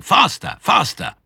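high, frantic shouted command; shouting. A narrator's reading. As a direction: spur them on wildly.

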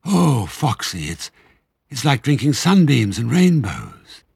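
high, halting, swooning delight; breathless awe with drawn-out words; gasping rapture. A narrator's reading. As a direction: stammer it out, overcome by the drink. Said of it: "he gasped".

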